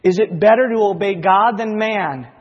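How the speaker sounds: ordinary talk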